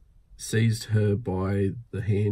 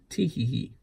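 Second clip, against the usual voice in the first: a gleeful little chortle, pitched higher; mild, higher gleeful chortle; 4 semitones higher than usual. A narrator's reading. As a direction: chuckle to yourself with glee. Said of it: "he chortled to himself".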